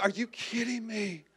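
slow, hushed, incredulous anger. Retold or imitated. Rider to his friend at the freeway on-ramp, hushed anger. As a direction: seethe it very softly and slowly. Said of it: "I'm so angry at him".

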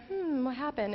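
soft, questioning mock murmur; puzzled guests. Guests puzzling over the failed cake, softly baffled. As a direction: imitate a hushed, puzzled reaction.

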